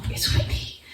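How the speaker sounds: breathy, near-whispered name; hushed announcement. Retold or imitated. Telling husband on the phone.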